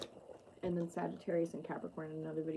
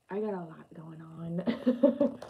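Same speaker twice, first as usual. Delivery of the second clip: whisper; 3 dB louder, much slower than usual. Real, not acted. Whispered aside about busy chart.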